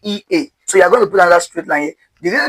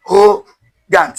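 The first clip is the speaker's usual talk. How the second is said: arrogant spoken very slowly, syllable by syllable; slow full word; much slower than usual. Shown as a demonstration. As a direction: stretch arrogant out for learners. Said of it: "So we have".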